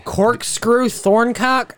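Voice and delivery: slow, high disbelieving question; incredulous. Real, not acted. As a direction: drag it out in disbelief.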